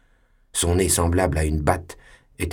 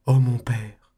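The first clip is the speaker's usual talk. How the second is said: higher, pleading exclamation; crying out; 5 semitones higher, 3 dB louder, slower than usual. Acted. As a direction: cry out Oh mon père on your knees. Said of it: "je m'écriai".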